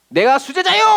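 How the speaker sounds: high, loud proud cry of a disciple; boastful Peter. Retold or imitated. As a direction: puff up and proclaim it as Peter. Peter boasts drawing his sword. (으스대며 외치는 베드로)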